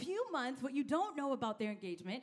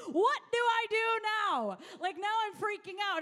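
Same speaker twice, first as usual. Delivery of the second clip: higher, louder mock freak-out; mock panic; 6 semitones higher, 6 dB louder than usual. Pastor mimicking overwhelmed churchgoers, mock panic. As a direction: play up the panic for laughs. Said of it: "Some of us are like".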